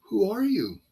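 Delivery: high, wary question in a put-on voice; startled Satanist. Satanist demanding who the preacher is, high and wary. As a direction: ask it as the shaken stranger.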